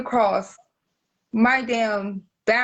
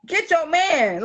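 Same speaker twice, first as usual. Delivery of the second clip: ordinary delivery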